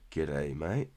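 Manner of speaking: light mock Aussie greeting; a first, lighter take at an Australian g'day; Aussie accent. Greeting a viewer in a light mock Aussie accent. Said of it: "That was my Aussie impression".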